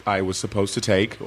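usual speaking voice

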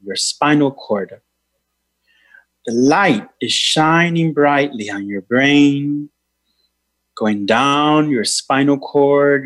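meditation guide voice; guided meditation. Acted. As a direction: lead a healing meditation. Leads a healing meditation.

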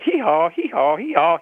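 high hee haw bray; donkey bray. Retold or imitated. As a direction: bray like a donkey, pitch jumping up. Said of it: "And he said".

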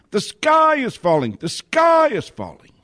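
high-pitched panicked cry, imitated; mock alarm, voice leaping high on each cry; mock screaming. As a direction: cry it out high like a mocking doomsayer. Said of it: "They've come screaming".

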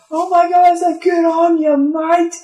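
very high, loud mock Australian cheer; Australian shark. Sharks cheering a confession, loud high Australian. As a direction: cheer him on like an Aussie.

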